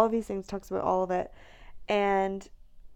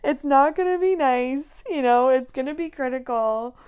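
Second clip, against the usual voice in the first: excited; 5 semitones higher, 9 dB louder than usual. Real, not acted. Retelling her unreasonable mood.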